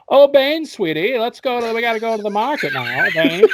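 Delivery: high, swooping, sugary coaxing; hammed-up high-pitched sweet talk to a henchman; Uma impression. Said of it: "was that your Uma impression".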